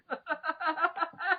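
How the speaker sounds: high breathy laugh; high giggle. Real, not acted. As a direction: giggle helplessly. Laughs at own reading.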